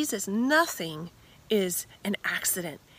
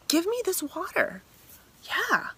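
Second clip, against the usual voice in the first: lightly raised, higher pleading voice; a thirsty woman asking Jesus for the water, higher and eager; 10 semitones higher than usual. Acted.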